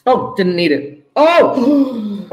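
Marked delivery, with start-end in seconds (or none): 1.1-2.3 surprised. Real, not acted. Spots own error.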